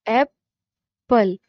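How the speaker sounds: "एप्पल" slowly, long gap between its two syllables. Shown as a demonstration. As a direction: split "एप्पल" into two distinct jaw-drop beats. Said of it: "उतने उसमें syllable होंगे". ("एप्पल" के दोनों हिस्से अलग-अलग, धीरे)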